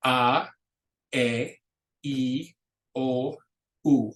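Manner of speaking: each vowel short and pure, spaced out; Spanish vowels. Shown as a demonstration. Teacher modelling vowels for the class, short and pure. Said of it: "So the vowels are".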